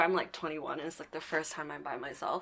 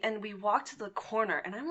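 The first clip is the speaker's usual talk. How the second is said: ordinary talk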